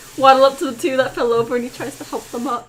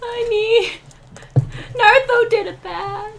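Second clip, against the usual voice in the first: high, pitiful character voice; guilty confession; 9 semitones higher than usual. Acted. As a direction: confess like someone in big trouble.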